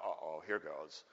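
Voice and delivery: low, soft uneasy aside; mock dread. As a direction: mutter it low, bracing for trouble. Kids dread the approaching bullies.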